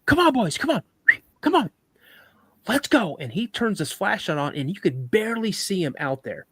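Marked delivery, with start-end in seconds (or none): 0.0-3.2 high-pitched call to the dogs; re-enacted dog call, voice raised in pitch. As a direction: call them in with short, high bursts. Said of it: "So we start calling him".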